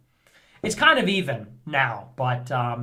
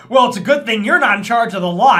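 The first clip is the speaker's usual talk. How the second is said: snooty comeback; 5 semitones higher, 10 dB louder, faster than usual. Retold or imitated. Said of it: "she was like".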